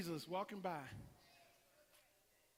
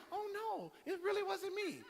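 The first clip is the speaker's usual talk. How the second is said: meek denial; 10 semitones higher than usual. Acted. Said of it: "He wasn't like".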